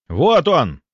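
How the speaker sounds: loud, high-pitched cry of discovery; hunter's shout. Acted. Hunter spotting the beast under the bed, shouting. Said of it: "закричал охотник".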